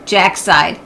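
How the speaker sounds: ordinary delivery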